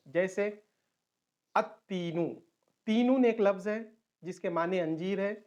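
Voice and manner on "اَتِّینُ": put-on at-teenu for "التینو", lam silent and t doubled; assimilated lam. Shown as a demonstration. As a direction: join the alif straight to a doubled t in "التینو". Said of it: "sun letter پہ تشدید ڈال کے". ("التینو" میں لام خاموش، ت پر تشدید)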